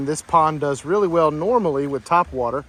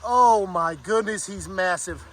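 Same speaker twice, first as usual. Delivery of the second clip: high, stunned cries of amazement; losing it over a massive bass; freaking out; 3 semitones higher than usual. Real, not acted. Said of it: "I am freaking out".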